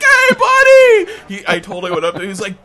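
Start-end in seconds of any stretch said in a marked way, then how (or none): 0.0-1.1 high, loud, drawn-out buddy yell; imitated overjoyed surfer shout; hollered greeting. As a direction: whoop it like a surfer reunion. Surfer greets supposed old friend.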